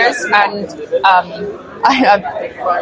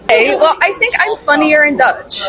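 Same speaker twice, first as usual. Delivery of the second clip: ordinary delivery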